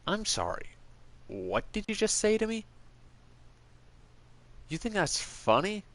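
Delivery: mock outraged comeback; offended retort. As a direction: act out being insulted and squaring up. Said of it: "you probably would just end up being like".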